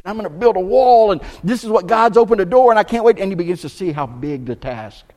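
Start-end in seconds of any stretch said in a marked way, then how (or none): 0.0-3.2 louder, higher, eager burst; excited. Retold or imitated. Nehemiah arriving at Jerusalem, loud and eager. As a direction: let the eagerness spill out. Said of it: "he's excited".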